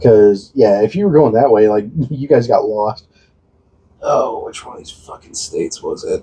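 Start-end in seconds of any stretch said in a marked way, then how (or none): 4.0-6.2 muttered to himself under the breath; voiceless muttering at the screen. Real, not acted. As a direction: mutter it while squinting at a map. Searching a map, muttering.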